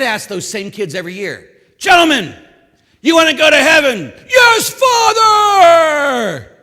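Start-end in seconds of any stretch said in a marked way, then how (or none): 1.8-4.1 high, loud rallying call. Retold or imitated. Priest asking his boys about heaven in a high, loud rallying call. 4.3-6.5 high, loud, very slow shouted answer; drawn-out eager shout. Retold or imitated. Boys answering their priest with a high, loud, drawn-out shout.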